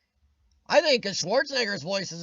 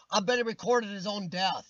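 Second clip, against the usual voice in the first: ordinary talk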